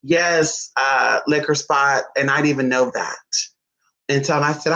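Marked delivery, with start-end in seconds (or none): 2.2-3.1 whisper. Real, not acted. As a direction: whisper the aside. Whispered aside.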